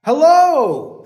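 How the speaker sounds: loud, high hello; calling out. Acted. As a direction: call it down the trail. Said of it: "Alibi called back".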